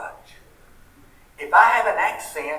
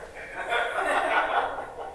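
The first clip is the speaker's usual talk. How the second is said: soft laugh; 10 semitones higher, 5 dB quieter than usual. Real, not acted. Laughs at own ice cream joke.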